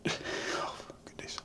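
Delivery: breathy whispered oh goodness; sighing whisper. Real, not acted. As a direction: whisper it after a weary sigh. Frustrated aside after a sigh.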